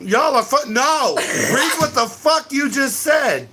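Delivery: high loud slow disbelief; outraged. Real, not acted. Scolding the commenter for what she posted, loud outrage. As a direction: demand she reread it, exasperated.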